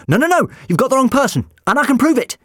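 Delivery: high, loud panicked protest; panicked high shout. Acted. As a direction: yell it in panic. Doctor lifted off the ground.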